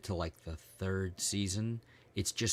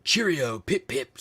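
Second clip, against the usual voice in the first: loud, high mock-British exclamation; hammed-up British accent; 6 semitones higher, 9 dB louder, slower than usual. Showing how fake accents sound to English people, hammed-up British accent. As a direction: belt out a caricature English accent. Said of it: "sounds like to English people".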